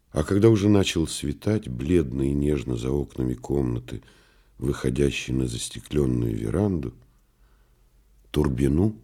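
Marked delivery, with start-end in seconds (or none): none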